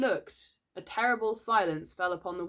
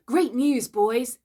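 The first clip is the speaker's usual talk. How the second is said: loud, high, excited announcement; cried tidings; 6 semitones higher, 7 dB louder, slower than usual. A narrator's reading. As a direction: herald glorious news to the band. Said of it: "he cried".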